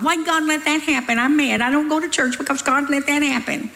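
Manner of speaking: lightly mock a sulking complainer; mock whiny complainer. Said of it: "People are always blaming God".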